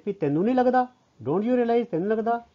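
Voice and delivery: high, incredulous challenge; acted reproach. Acting Veero's reproach to Pala.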